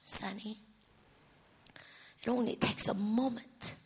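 soft, low, near-whispered reassurance; gentle divine reply. Retold or imitated. God answering his distraught daughter, softly and gently.

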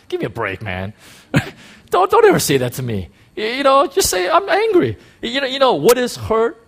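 exasperated laughing rant. Real, not acted. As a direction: scold them with incredulous laughter. Preacher scolding with laughter.

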